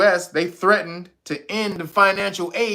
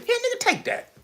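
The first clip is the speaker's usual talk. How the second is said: high, wheedling pushy offer; mock America bribing; 4 dB quieter than usual. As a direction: coax them to take the money. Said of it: "he reaches in your pocket".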